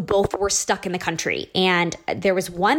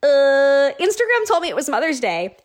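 defensive reply; 5 semitones higher than usual. Retold or imitated. Daughter-in-law explaining her mistake, defensive. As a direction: imitate yourself caught out, pitched up.

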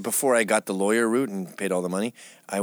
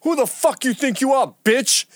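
high, loud mock outrage; imitating angry guy; 12 semitones higher, 5 dB louder than usual. Imitating the vet cursing him out in line, loud and high. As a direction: ham up a furious stranger in line. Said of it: "And he goes".

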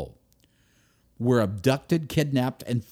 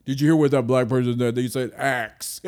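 mocking gossip; 4 dB louder than usual. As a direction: sneer like a gossip mocking speech.

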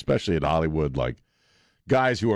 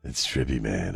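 stoned hippie; 4 semitones lower, 3 dB quieter than usual. Pretend. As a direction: play a spaced-out hippie. Hippie stoner voice about the song.

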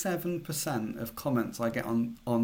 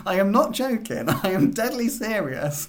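giggling through the words, raised and high; can't keep a straight face; laughing; 6 semitones higher, 5 dB louder than usual. Real, not acted. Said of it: "I can't answer this without laughing".

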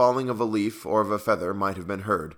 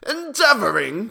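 high, loud, drawn-out incredulous question; fierce cry; 13 semitones higher, 6 dB louder than usual. A narrator's reading. Tyrant echoing the dwarf's word, fierce and high. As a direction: throw the word back in outrage. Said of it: "cried the tyrant fiercely".

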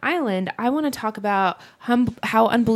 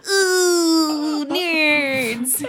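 a higher, laughing put-on nerd; Conan nerd voice; 6 semitones higher than usual. Imitating Conan pretending to be a nerd, laughing. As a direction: whine it like a mock nerd. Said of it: "That's how Conan talks when he's pretending to be a nerd".

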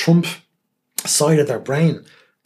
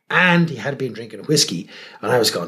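ordinary talk

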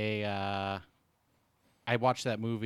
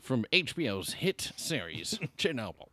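announcer voice; 6 semitones higher than usual. Acted. Mock show announcement.

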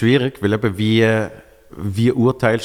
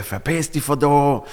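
drawn-out, higher mock yell; retold freak-out; 5 semitones higher, slower than usual. Guest clarifying the stranger's hostile outburst, mock freak-out. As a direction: imitate an angry man lashing out. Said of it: "nicht ausgerastet im positiven Sinn".

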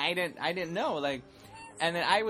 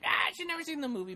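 high, quick mock fan cry; imitating fans; 13 semitones higher, faster than usual. Host imitating amused fans, high mock voice. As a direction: squeal it quickly like a teasing fan. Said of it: "they're right there being like".

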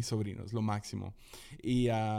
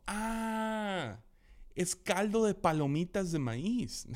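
high, slow little-kid voice imitation; lightly voiced small boy; childlike voice; 8 semitones higher, much slower than usual. Child's funny answer quoted.

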